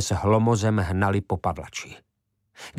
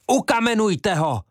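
very high, loud cry from the throat; roaring yell; 12 semitones higher, 4 dB louder than usual. Acted. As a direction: yell it with all your breath. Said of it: "Zařval tak Šlojme Kac". (zařvat z plna hrdla)

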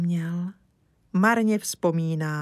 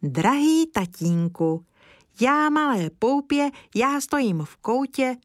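put-on little girl reciting; 6 semitones higher than usual. Zdeňa reciting her poem to father, childlike. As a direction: recite sweetly like a small girl. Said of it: "hned spustila".

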